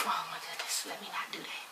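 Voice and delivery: unvoiced whisper, catching herself; whispered to self. Mother catching herself after yelling at daughter, whispered. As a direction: hush it like a regretful aside. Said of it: "I'd be like".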